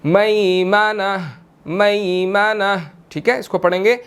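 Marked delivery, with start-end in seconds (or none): 0.0-2.9 slow मैमना with मै clearly open; slow fatha. Shown as a demonstration. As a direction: draw out मैमना, opening मै. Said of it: "मीम ज़बर या मई". (धीमे मैमना, ज़बर साफ़)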